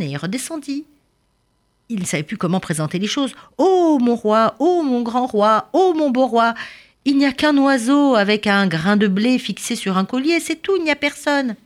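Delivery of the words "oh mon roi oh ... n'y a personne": high, loud, grovelling servant's plea; fawning servant. A narrator's reading. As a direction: flatter the king in a high loud voice. Servant flatters king nervously. (serviteur flatteur, voix aiguë et forte)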